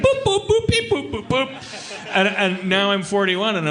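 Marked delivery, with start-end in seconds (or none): 0.0-2.1 high robotic boop noises; robot beeping. Retold or imitated. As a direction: beep like a reprogrammed robot. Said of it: "opened the panel and was like".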